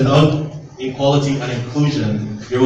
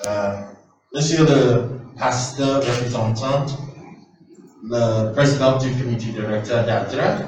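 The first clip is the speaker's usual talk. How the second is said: halting French with an English accent; broken french. Real, not acted. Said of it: "euh, un peu français".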